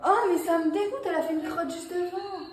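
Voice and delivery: voix plus haute, écœurée; dégoûtée. Real, not acted. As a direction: montrer son dégoût. Dégoût devant la souris. (écœurée par la crotte de souris)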